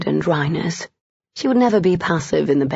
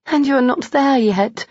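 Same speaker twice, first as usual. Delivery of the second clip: Amelia's voice; 8 semitones higher than usual. A narrator's reading. Woman voiced in love scene.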